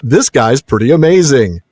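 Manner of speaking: laughing. Real, not acted. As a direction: laugh out loud while admiring him. Laughing while praising Faramir.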